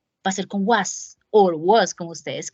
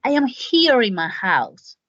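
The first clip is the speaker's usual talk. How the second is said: slow model sentence; slower than usual. Shown as a demonstration. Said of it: "vamos a meterle más cosas".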